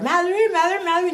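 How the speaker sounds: high, searching calls; calling out. Retold or imitated. Lost kids calling for their mother in the forest. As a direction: call her name into the woods.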